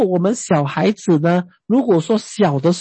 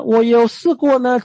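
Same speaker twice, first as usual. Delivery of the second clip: ordinary talk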